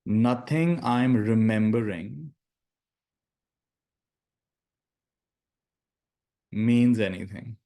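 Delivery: slow, deliberate meditation phrases with long silences; guided meditation. Acted. Teacher giving students lines to repeat in their minds, slow meditative delivery. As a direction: lead them slowly through each phrase, leaving space to repeat it.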